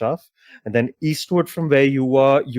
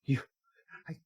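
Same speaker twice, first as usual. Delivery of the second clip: stunned stammer; 6 semitones higher than usual. Acted. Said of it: "she just goes".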